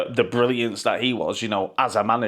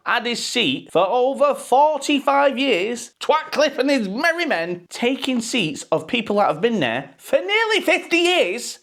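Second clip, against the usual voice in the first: outraged; 12 semitones higher than usual. Real, not acted. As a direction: stretch it out in disbelief. Outraged at seat removals.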